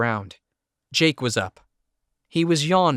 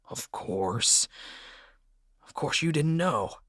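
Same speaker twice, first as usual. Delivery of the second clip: quiet despair; 3 semitones higher, 6 dB quieter than usual. A narrator's reading. Carl despairs, grunts.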